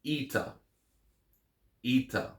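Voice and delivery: Eta said EE-tah with a long E. Shown as a demonstration. Teacher modelling Eta with long E sound. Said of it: "that long E sound".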